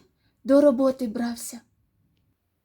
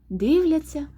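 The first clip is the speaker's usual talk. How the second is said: ordinary delivery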